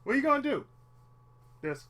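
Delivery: normal talking voice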